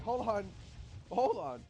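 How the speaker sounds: loud plea to wait; urgent plea. Retold or imitated. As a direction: stop her mid-swab. Begging the nurse to pause.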